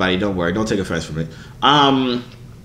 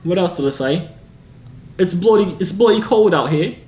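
higher-pitched fake British; improvised British impression, pitched high; mock British accent; 6 semitones higher than usual. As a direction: ham up a playful British accent. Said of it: "you like the British".

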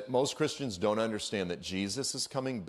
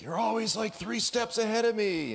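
higher, put-on complaining cartoon voice; whiny Satan; 12 semitones higher than usual. Preacher voicing Satan complaining to God, higher whiny put-on voice. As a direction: moan it like a sore loser. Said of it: "Satan's like".

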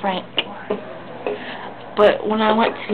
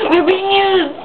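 mock outrage; 7 semitones higher, 5 dB louder, slower than usual. Repeats line in higher outraged voice.

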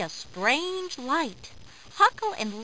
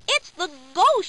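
raised, higher storybook shout; Lowly shouting; 6 semitones higher, 5 dB louder than usual. A narrator's reading. Lowly warning Huckle about the ghost, shouting. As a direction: shout it in alarm, pitched up. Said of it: "shouts Lowly".

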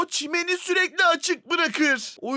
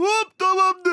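ordinary talk

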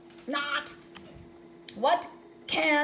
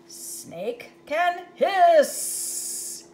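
very slow, louder, hissing the s; slow hissing read; 5 semitones higher, 7 dB louder, much slower than usual. Acted. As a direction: draw out the hiss.

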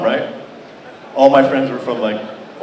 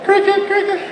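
mock crickets. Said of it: "mimics silence of crowd".

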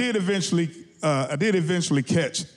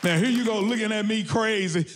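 ordinary delivery

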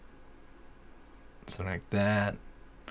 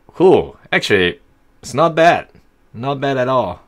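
pleased excitement; 8 semitones higher, 11 dB louder than usual. Real, not acted. Pleased by his own creation.

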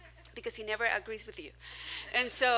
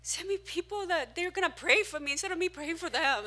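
pleading prayer; 7 semitones higher than usual. Retold or imitated. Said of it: "And I'm crying".

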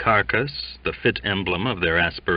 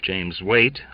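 ordinary delivery, nothing marked

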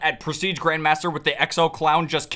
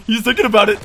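louder and higher, stress on thinking; 3 semitones higher, 5 dB louder, faster than usual. Real, not acted. Gamer warning about an enemy's next move, louder. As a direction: call out the suspicion. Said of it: "I know he's thinking about it".

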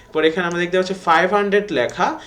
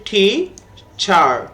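"Teacher" in two separate beats, tea, char; split into syllables; 3 dB louder, much slower than usual. Shown as a demonstration. Teacher dividing "teacher" for pupils, split into two syllables. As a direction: say "teacher" slowly, pausing between its syllables. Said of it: "আমরা ভাগ করি".

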